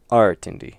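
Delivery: Artindi with AR punched loud, AR-tin-di; stress on first syllable. Shown as a demonstration. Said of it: "put it on the first syllable".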